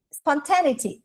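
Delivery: "Spontaneity" as spon-TEN-ity, stressed on the second syllable; mistaken pronunciation. Shown as a demonstration. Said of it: "Eu falava assim".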